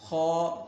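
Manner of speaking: خا full and rounded, toward "khaw"; thick خا. Shown as a demonstration. Teacher models heavy letter, خا thick and held. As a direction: hold خا loud with heavy rounded vowel. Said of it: "خا کو موٹا ہی پڑھنا ہے".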